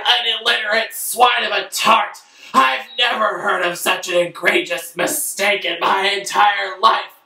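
pompous father scolding his son; outraged father. Acted. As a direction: rage like a snobbish father.

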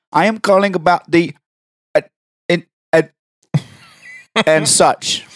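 prank caller voice broken into fragments; phone cutting out. Acted. Caller faking a bad line to the receptionist, cutting out. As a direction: drop out mid-sentence like a bad connection. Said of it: "your phone cut out as soon as".

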